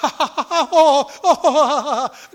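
loud, high forced guffaw; big fake laugh. Shown as a demonstration. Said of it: "you can just fake laugh".

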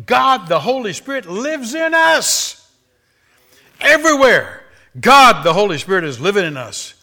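high, loud, soaring preacher's cry; full-force preaching. Real, not acted. Preacher rousing the congregation, full force. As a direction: lift the church with it.

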